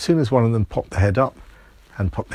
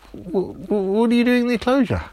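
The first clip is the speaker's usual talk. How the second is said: higher, fast, tripping start then rushing on; a boy too flustered to get the words out; stammering boy; 13 semitones higher than usual. Acted. Timmy stammers at the girls.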